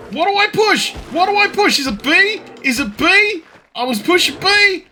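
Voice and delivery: high panicked screaming curses; swearing tirade. Real, not acted. Bear attacks, screaming curses.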